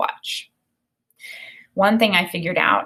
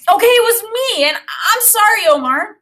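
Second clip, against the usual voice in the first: put-on much higher, louder boy blurting it out; blurted confession; 14 semitones higher, 6 dB louder than usual. A narrator's reading. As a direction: let the apology burst out of him. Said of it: "Daniel blurted out".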